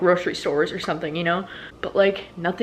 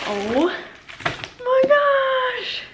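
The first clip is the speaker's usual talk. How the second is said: excited squeal; much slower than usual. Real, not acted. Unboxing a surprise package.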